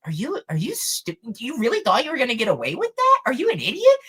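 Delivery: high, rising disbelief; incredulous mockery. Everyone else answering the accuser, incredulous and mocking. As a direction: imitate a crowd scoffing, voice climbing. Crowd mocks the liar, retold.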